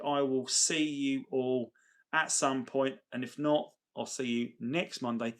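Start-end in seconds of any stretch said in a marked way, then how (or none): none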